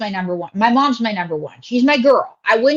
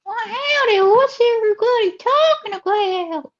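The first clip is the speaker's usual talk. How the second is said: Ruby Chapeau; 11 semitones higher than usual. Acted. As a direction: put on a big, high Southern accent. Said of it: "This is, uh, Ruby Chapeau".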